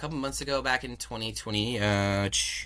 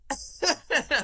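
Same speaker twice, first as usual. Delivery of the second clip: high bright burst of laughter; real laugh. Real, not acted. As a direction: laugh out in delight. Laughs retelling the offer.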